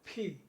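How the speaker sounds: ফি with air bursting from the lips; aspirated P. Shown as a demonstration. Teacher repeating P for pupils, aspirated and emphatic. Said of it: "বাতাস আসতে হবে".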